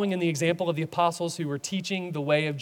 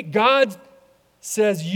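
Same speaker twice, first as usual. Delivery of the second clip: ordinary talk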